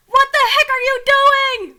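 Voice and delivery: high, loud, drawn-out yell; mock growled outburst. A narrator's reading. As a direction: roar it out in exaggerated outrage. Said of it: "Putin growled".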